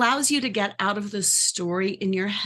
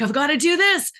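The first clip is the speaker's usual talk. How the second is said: higher, louder, hurried panic; anxious rush; 7 semitones higher, 3 dB louder, faster than usual. Retold or imitated. Overwhelmed person feeling they must rescue everyone, anxious and rushed. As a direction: spill it out like you're overwhelmed. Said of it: "a bit of that anxiety".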